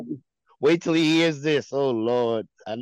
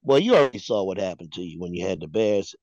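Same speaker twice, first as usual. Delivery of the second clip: normal talking voice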